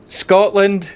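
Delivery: Scotland cried out high and loud, falling; a sudden overwhelmed call of the name Scotland; real emotion breaking through; moved outcry. Real, not acted. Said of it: "You know how to get people emotional".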